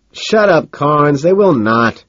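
loud, high outburst; brazen blurt. A narrator's reading. Narrator blurts at Carnes across the talk, brazenly. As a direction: blurt it out sharply, pitch leaping up. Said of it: "brazen enough to blurt out".

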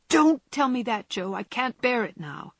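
fierce outcry. A narrator's reading. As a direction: cry it out fiercely. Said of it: "cried out in a fierce tone".

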